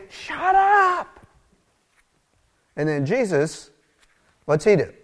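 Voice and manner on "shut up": high, sharp shut up; scolding shout. Acted. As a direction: crowd telling the beggar off. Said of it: "And they".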